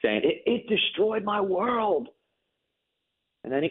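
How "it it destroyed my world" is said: a mild retelling of a son's anguish; retold anguished cry. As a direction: echo a grieving son's broken words. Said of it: "he literally was yelling".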